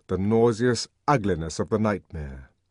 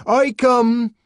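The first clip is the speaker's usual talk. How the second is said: high, loud, slow declaration; proclamation; 10 dB louder than usual. A narrator's reading. Savage proclaiming freedom to the twins, loud. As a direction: proclaim liberation.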